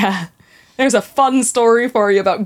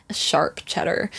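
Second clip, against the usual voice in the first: mock American drawl on "A sharp cheddar", soft and slow; American accent; 6 semitones lower, 7 dB quieter than usual. Guest imitating how Americans say it, mock American. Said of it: "It's what Americans say".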